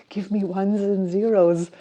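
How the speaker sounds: loud, playful demand right after a laugh; mock demand. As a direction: burst out with a jokingly insistent demand. Acted demand put to the chatbot.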